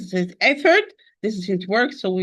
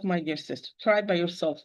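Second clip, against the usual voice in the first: usual speaking voice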